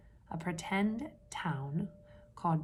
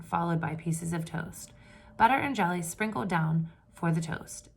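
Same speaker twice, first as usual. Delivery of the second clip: steady read-aloud storytelling voice; story narration; 5 dB louder than usual. A narrator's reading. Teacher reading a picture book to the class, storytelling voice.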